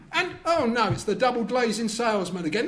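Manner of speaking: usual speaking voice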